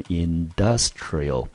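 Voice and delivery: industrial, slow, with the weight on DUS; stress on the second syllable. Shown as a demonstration. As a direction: model industrial slowly, stressing in-DUS-trial. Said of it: "industrial是在第二音节". (慢慢念industrial，重音在第二音节)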